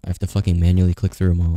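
ordinary talk, nothing marked

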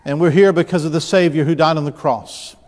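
ordinary talk, nothing marked

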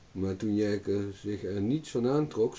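ordinary delivery

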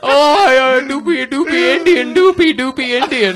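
loud, very high, silly mock Indian accent; mock Indian impression. Panelist showing off a bad impression in a loud mock Indian accent. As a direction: belt out a hammed-up, badly faked Indian accent. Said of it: "never been known for my impressions".

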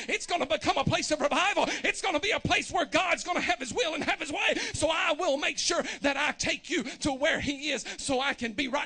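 rising from speaking to full shouting preach; building sermon. Acted. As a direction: build the fervour until you're shouting.

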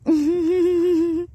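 high, loud giggle; real laugh. Real, not acted. Amused by the cat.

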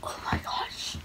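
breathless stunned whisper. Real, not acted. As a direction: whisper it in disbelief. Young self stunned after the win.

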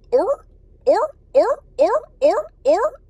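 loud, high, rising seal barks; seal barking. Acted. Adult giving a child an animal clue, loud seal barks. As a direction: bark like a seal in short whoops. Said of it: "something that you see in the ocean that makes that sound".

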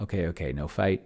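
normal talking voice

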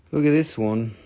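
very low-pitched invitation; low show-off. Pretend. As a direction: point it out deep and low. Shows a piece in two voices.

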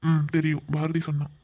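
ordinary talk, nothing marked

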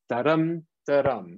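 "da-dum, da-dum" with short "da" and long "dum"; stressed iambs. Shown as a demonstration. Teacher shows how to stress the syllables, stressed iambs on "da-dum". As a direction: lean on each "dum" in "da-dum". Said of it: "you stress them".

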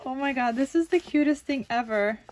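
ordinary talk

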